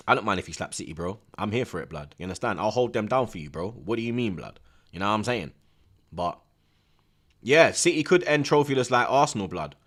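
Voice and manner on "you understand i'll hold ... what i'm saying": ordinary talk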